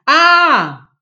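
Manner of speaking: sharp high loud cry of pain; wife crying out as if scalded, high and loud; cry like a burn. A narrator's reading. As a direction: yelp as if burnt. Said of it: "comme une brûlée".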